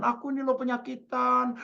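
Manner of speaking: high, plaintive imitation of a sufferer; lament with full conviction. Sick friend retells suffering face to face, high convinced lament. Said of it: "diceritain lagi dengan penuh keyakinan".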